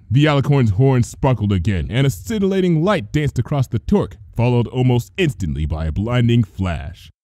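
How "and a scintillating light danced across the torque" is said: usual speaking voice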